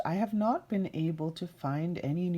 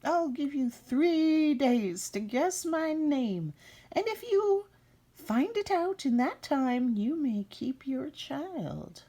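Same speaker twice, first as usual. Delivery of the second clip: high, slow imp offer fading to a whisper; high sly goblin bargain; little man's voice; 10 semitones higher, slower than usual. Acted. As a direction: tempt her, then whisper the last words. Little man offers deal.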